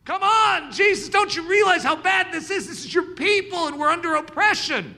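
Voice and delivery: loud, high, urgent plea to Jesus; pleading shout. Acted. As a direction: cry out to Jesus in frustration. Preacher voicing plea to Jesus.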